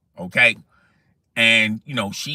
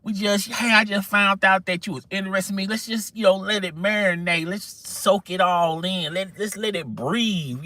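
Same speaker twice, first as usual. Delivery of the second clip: higher, half-whispered backing away; nervous brush-off; 6 semitones higher than usual. Retold or imitated. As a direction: stall her gently, hands up, easing out. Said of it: "I'm going like".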